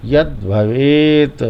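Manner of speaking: यत् भवेत slowly, stop on त्, long वे; halted half letter. Shown as a demonstration. Teacher repeats for pupils, stopped yat then bhavet. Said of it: "यहीं से बोलेंगे भवेत".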